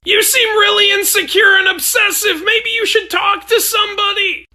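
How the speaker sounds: high, loud distressed yelling; high-pitched frantic shouting match. Acted. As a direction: shout over the chaos in disbelief. Frantic argument after stabbing.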